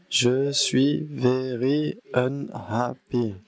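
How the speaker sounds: one syllable per person, dragged out; slow drawn-out complaint. Shown as a demonstration. Teacher demonstrating the exercise sentence, slowly drawn out. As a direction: stretch every syllable, slowly and glumly.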